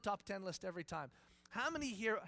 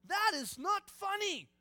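much higher and louder, hammed-up fright; startled panic; 11 semitones higher, 7 dB louder, slower than usual. Moses panicking at God as the staff becomes a snake. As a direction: yelp it like you nearly got bitten. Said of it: "Moses is going".

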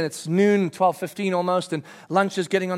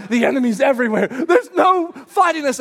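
louder, laughing surrender; laughing defeated officer; 4 semitones higher, 5 dB louder than usual. Retold or imitated. Overrun officer to his men: laughing despair. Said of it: "He's like".

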